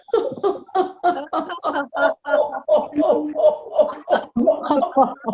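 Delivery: high open-hearted laughing; forgiveness laughter. Shown as a demonstration. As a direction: laugh freely to ask for forgiveness. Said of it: "there's forgiveness laughter".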